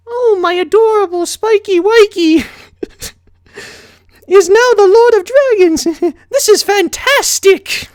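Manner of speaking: Rarity fawning over Spike; giggly fancy pony. A narrator's reading. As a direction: coo and giggle over him. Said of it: "Rarity's titter".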